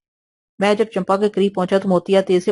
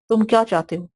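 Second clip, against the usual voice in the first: frightened tone; 3 semitones higher than usual. A narrator's reading. Cornered Champa asks the intruder, frightened. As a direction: ask it cornered and scared. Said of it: "وہ خوفزدہ لہجے میں بولی".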